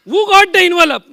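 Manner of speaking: high, loud question to the crowd; loud showman call. Shown as a demonstration. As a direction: call it out to the whole hall. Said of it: "ऐसे पूछूंगा।". (जोर से ऊँची आवाज़ में पुकारना)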